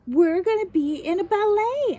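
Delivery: high, loud burst of excitement; excited announcement. A narrator's reading. Girl tells mom and JoJo her thrilling news, excited.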